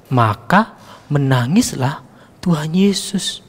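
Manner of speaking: ordinary talk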